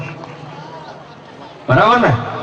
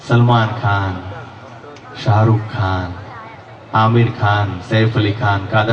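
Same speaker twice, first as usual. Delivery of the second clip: deep star impression; 7 semitones lower, slower than usual. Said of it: "mimicry મળે".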